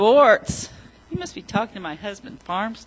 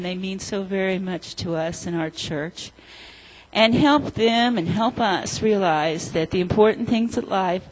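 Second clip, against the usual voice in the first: prayer; 3 semitones lower, 4 dB louder than usual. Acted. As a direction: pray gently over the children. Leads children in prayer.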